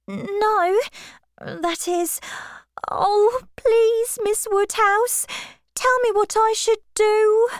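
begging for help, close to tears; distressed young Harriet. Acted. Harriet begging Miss Woodhouse what to do, tearful high plea.

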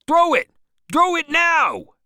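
urgent high yell, last word stretched; cried out. A narrator's reading. Boat leader urging the harpoon throw, yelling. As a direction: scream the order at full pitch. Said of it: "cried Stubb".

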